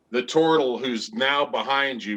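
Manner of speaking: ordinary delivery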